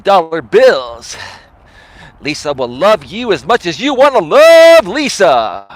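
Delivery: loud, slow, drawn-out club announcer; strip club DJ. Retold or imitated. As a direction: hype the dancer onto the stage. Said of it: "the same voice as a strip club disc jockey".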